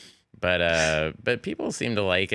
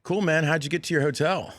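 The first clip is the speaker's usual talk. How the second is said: much higher California dude; curious Californian friend; 9 semitones higher than usual. Retold or imitated. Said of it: "a bit that Fred and Keenan and I used to do".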